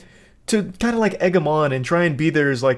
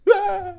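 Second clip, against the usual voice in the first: high laughing yelp; 3 dB quieter than usual. Retold or imitated. Mocking the rise.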